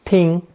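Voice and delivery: "Ting" on a high, level first tone. Shown as a demonstration. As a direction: say "Ting" high and flat in pitch, held steady. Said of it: "also pronounced first tone".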